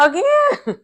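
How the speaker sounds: very high-pitched squeak of disbelief; incredulous. Retold or imitated. Reacting in disbelief to the accusation.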